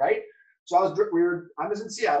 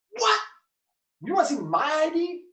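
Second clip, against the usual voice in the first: baffled disbelief; 12 semitones higher, 4 dB louder than usual. Retold or imitated. Retold baffled reply after crash.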